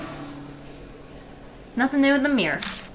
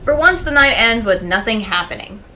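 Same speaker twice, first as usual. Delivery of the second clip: story narration; 3 semitones higher, 7 dB louder than usual. Acted. Reading game text aloud.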